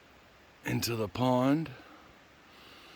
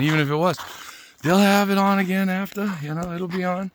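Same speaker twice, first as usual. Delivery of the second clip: much higher, lively aside; high excited aside; 10 semitones higher than usual. Real, not acted. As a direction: lift the voice way up. Excited aside, pitch jumps.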